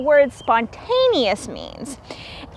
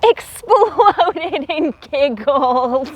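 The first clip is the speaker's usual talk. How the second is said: reading into a giggle; giggling; 3 semitones higher, much slower than usual. A narrator's reading. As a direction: break into a light giggle. Narrating giggles.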